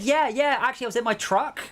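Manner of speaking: high, eager mock claimant; suggested reply. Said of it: "you should have said".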